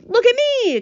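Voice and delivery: very high, loud squeal; excited cry. A narrator's reading. Centipede showing off to the others, high excited cry. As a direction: cry out high to get attention. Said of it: "cried the centipede".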